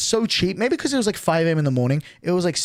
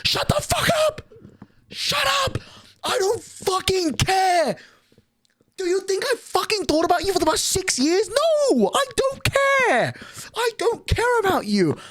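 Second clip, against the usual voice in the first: shrieking much higher, screaming at her; screaming rant; 14 semitones higher than usual. Pretend. Pretend yelling at the old classmate, high shrieking. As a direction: pretend rage shriek, voice cracking.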